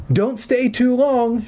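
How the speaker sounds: high, slow mother's warning; stern instruction. Retold or imitated. Mother warning the caller, high and slow. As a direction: lay down the rule slowly. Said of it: "she instructed".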